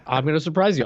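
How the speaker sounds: ordinary talk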